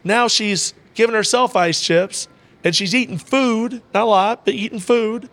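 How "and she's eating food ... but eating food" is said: higher, lit-up disbelief; amazed, delighted. Real, not acted. Telling the host about grandma, amazed and delighted. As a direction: marvel at her recovery.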